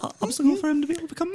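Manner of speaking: fast, very high needling voice. Acted. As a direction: race upward into a squeaky pitch. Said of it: "He's getting higher and higher pitch".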